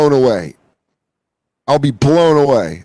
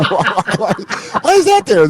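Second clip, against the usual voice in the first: high, laughing mock alarm; giggly, alarmed squeal; laughing disbelief; 6 semitones higher than usual. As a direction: ask it through laughter, climbing high. Acted alarm at urn, while laughing.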